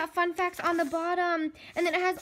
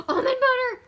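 surprised; 10 semitones higher, 6 dB louder than usual. Real, not acted. Surprised by gift.

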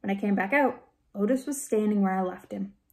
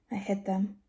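hushed, softer confession; whispered; 5 dB quieter than usual. A narrator's reading. Shy man admitting to a girl what he did, hushed. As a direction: say it softly, nearly a whisper. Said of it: "he whispered".

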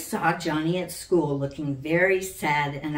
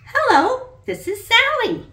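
put-on high, loud child voice, polite and eager; little girl on the phone; 8 dB louder than usual. A narrator's reading. Sally calls the princess to ask a favour, high and eager. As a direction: speak up like a small girl making her first call.

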